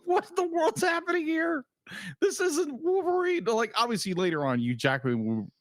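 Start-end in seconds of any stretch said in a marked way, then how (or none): 0.0-3.4 high-pitched mock bewilderment; retold disbelief. Viewer recalling the Aussie Wolverine, in high disbelief. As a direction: act out baffled disbelief, pitched way up. Acts out own startled reaction.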